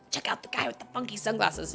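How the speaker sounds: hushed nudge-nudge imitation; retold stage whisper. Kid to Eliza about funky sunglasses, hushed aside. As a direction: say it under your breath.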